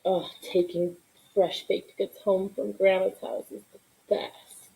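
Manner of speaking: usual speaking voice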